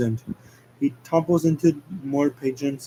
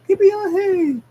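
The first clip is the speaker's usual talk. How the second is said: squeaky high yippee, much higher; high cheer; 4 dB louder than usual. Acted. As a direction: cheer like a giddy cartoon hedgehog. Sonic cheering on the bull.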